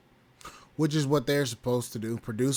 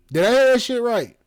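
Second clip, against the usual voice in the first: loud, high-pitched disbelief; incredulous; 9 semitones higher, 7 dB louder, faster than usual. Real, not acted. Host reacting to a lawsuit he finds stupid, incredulous. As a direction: blurt it out in raised, incredulous disbelief.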